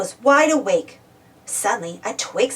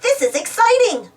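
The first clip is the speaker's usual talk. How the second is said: higher, eager character voice; excited; 11 semitones higher, 4 dB louder than usual. Acted. Muck to Travis about a first night in the country, excited. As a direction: say it with excited lift.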